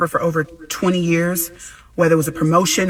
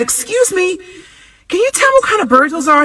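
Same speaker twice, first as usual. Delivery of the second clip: high, sweet polite asking voice; 13 semitones higher, 4 dB louder than usual. Retold or imitated. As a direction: put on a nice polite voice for a stranger. Polite question to passerby.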